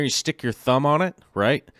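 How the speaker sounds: ordinary delivery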